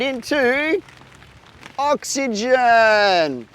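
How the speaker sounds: slow, stretched oxygen; drawn-out reveal. Acted. Host revealing the answer to kids, slow and stretched. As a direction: savor the word.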